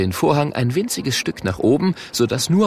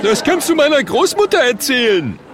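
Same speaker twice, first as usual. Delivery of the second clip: high, loud scoffing heckle; disbelieving shout; 5 dB louder than usual. Acted. A spectator mocking the Murk, shrieked disbelief. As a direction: jeer it in a high voice from the crowd. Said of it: "die Leute rufen".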